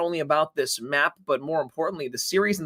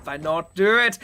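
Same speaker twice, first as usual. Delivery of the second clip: short burst of fake German accent; a joky, badly faked German accent; mock German accent; 4 dB louder, slower than usual. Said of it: "cast in a German accent".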